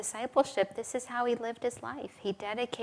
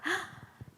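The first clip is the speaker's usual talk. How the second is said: sudden high intake of breath; acted gasp; 10 semitones higher, 7 dB quieter than usual. Speaker acts realizing at five o'clock, sudden gasp. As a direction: gasp as if just remembering.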